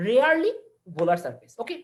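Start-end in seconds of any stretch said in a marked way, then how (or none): none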